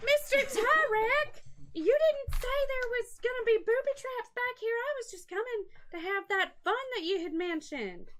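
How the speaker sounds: high, breathy-free seductive purr; sexy lady voice. Acted. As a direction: sweet-talk the guard as a sexy lady. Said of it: "modulate my voice to sound like".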